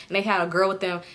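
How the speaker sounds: ordinary talk, nothing marked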